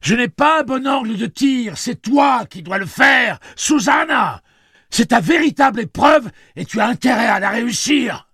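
high, loud urgent shouting; cri. A narrator's reading. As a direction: shout it as a forceful order to a partner. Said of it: "cria celui-ci". (exhortation criée avec force)

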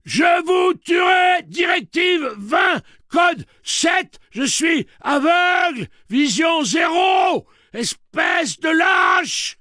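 slow, high screaming rage; drawn-out full-force furious yelling; bawling robot. A narrator's reading. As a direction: bellow each phrase like a berserk machine. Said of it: "brailla Andy".

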